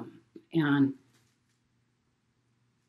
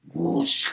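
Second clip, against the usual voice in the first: whooshing water noise; 3 dB louder than usual. Retold or imitated. Imitating rushing water noise.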